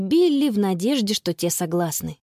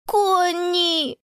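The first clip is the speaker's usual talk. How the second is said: put-on high child's cry; tearful little brother; 8 semitones higher than usual. A narrator's reading. Lost little boy spotting his sister, tearful cry. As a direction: call out as a small boy in tears. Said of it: "воскликнул её заплаканный братик".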